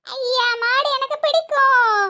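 put-on slow, gleeful kid voice; five-year-old child. Child delighted at playing cows with auntie, childlike. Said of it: "அஞ்சு வயசு குழந்தைதான்".

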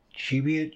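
Cheviot said CHEE-vee-ut, with a long ee, pitched high; alternative pronunciation. Shown as a demonstration. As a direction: try "Cheviot" with CHEE as the first syllable. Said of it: "however it's pronounced".

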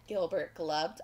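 usual speaking voice

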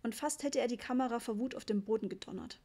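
ordinary delivery, nothing marked

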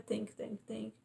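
hushed, grateful thank yous; soft repeated thanks. Retold or imitated. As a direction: breathe the thanks out, softly.

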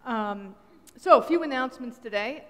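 ordinary delivery

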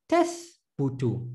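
syllables Tath, bu, tu spaced apart; sounding out words. Shown as a demonstration. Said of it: "I'm going very slow".

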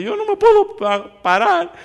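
high, loud, slow wailing voice; imitating sobbing. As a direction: act out the sobbing man, pitch way up. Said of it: "Y sigue llorando".

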